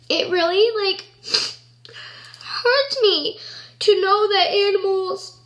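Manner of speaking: high, halting, tearful voice; trying not to cry. Real, not acted. Telling viewers animal cruelty hurts, fighting back tears. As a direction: fight real tears between broken phrases. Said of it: "I'm really trying not to cry".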